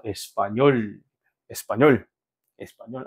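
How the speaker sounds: "에스파뇰" low, with 뇰 stressed; stress on final syllable. Shown as a demonstration. Teacher models stress rule, "에스파뇰" stressed on 뇰. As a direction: lean on the closing 뇰 of "에스파뇰". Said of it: "맨 마지막 음절에 강세가 있는".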